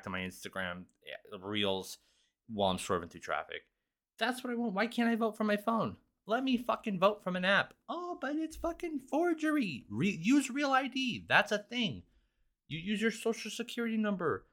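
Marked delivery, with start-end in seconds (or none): 4.2-14.5 mock whiny rant. Ranting about voting by app.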